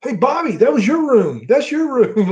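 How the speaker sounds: a hint of a gleeful snitch; mock tattling. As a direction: rat out your friend with glee. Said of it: "like that".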